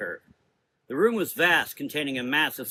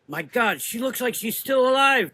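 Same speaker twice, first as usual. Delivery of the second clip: shocked exclamation; 10 semitones higher, 5 dB louder than usual. A narrator's reading. Rose sees the flailing body, horrified exclamation. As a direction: pitch jumping higher in horror. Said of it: "Rose exclaimed".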